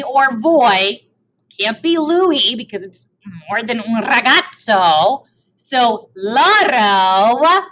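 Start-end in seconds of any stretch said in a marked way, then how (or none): 5.6-7.7 very slow "So loro" with "loro" rising; drawn-out reveal. Shown as a demonstration. Teacher slowly revealing the answer loro. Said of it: "drawing out the answer".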